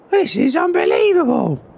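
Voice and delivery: amazed. Real, not acted. Amazed visitor in new barn.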